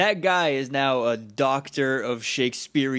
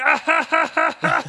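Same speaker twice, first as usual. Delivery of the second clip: much higher, louder hammed-up laughter; a forced actor's laugh at full stretch; fake hard laugh; 13 semitones higher, 5 dB louder than usual. Tommy fakes a huge laugh while his friends are sprayed. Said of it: "act laughing his hardest".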